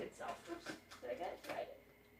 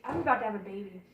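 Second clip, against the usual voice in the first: ordinary delivery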